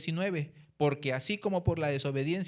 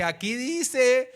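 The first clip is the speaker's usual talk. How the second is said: loud, slow and higher, spitting out each word; indignant retort; 5 semitones higher, 6 dB louder than usual. Retold or imitated. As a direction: face down the corrupt cop with outrage. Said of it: "y le dije". (reclamo indignado, fuerte y lento, al oficial corrupto)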